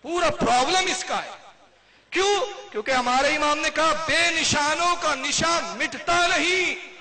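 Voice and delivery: high, loud, very slow chanted verse; fervent slow recital of the imam's lines; impassioned recitation. Real, not acted. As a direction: draw the couplet out from the pulpit. Preacher quotes imam's verse.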